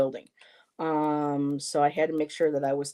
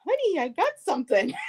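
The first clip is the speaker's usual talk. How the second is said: giggly announcement; 10 semitones higher, faster than usual. Retold or imitated. Showing her partner the package.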